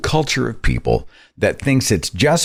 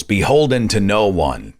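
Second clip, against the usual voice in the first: usual speaking voice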